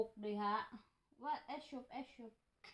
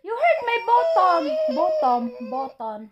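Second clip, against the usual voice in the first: loud, high half-laughing yelp; laughing ouch; 5 semitones higher, 16 dB louder than usual. Real, not acted. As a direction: cry out playfully at the hurt. Mother cries out, laughing, hurt. (nagsinggit nga nagkatawa sa sakit)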